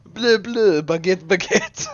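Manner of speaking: high, loud hammed-up French accent; pathetic mock French. Streamer making Xterp laugh with nonsense, crazy French accent. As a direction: squawk out silly French words. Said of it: "my pathetic French and the crazy accent".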